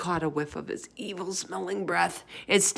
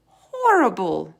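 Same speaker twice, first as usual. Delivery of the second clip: disgusted; 3 semitones higher, 9 dB louder, slower than usual. A narrator's reading. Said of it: "the B F G said".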